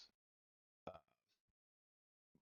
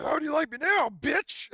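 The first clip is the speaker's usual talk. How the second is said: much higher, loud gloating yell; Tobias battle taunt; 13 dB louder than usual. Acted. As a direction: shout it in his face like a cocky soldier after the kill. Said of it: "the classic Tobias, uh, battle taunt".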